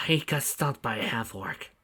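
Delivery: breathless, faint whisper; weak whisper. Acted. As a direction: rasp it out weakly. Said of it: "Grey Mouser coughed".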